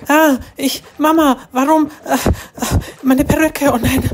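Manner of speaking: high, shrill-pitched panic as the wig comes off; panicked. Acted. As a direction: gasp out the words in shock. Wig pulled off before friends.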